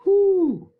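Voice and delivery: high, loud "whoo"; high-pitched shocked hoot. Real, not acted. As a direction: whoop at the harsh words. Reaction to harsh words.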